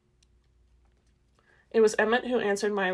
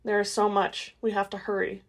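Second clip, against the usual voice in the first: plain reading voice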